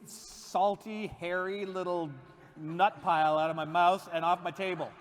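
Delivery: mock fury at a bean; loud, scolding outburst; angry rant. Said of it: "Why would I be so angry at edamame?".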